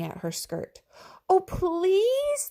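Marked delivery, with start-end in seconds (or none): 1.3-2.5 put-on high, loud child's plea; children pleading with their teacher, high and loud. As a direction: beg in a high little kid's voice. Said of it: "they pleaded".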